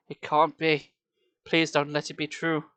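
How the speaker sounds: ordinary delivery, nothing marked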